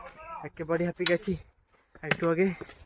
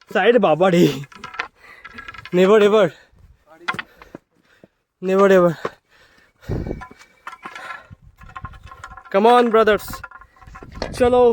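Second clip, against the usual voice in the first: calling out; 4 dB louder, much slower than usual. Real, not acted. Calling out to brothers climbing.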